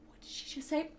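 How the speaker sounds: very quiet, baffled question; hushed aside. Retold or imitated. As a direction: imitate a coworker's under-the-breath question. Coworker turns to her, off headset.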